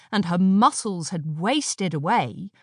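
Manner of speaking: ordinary delivery, nothing marked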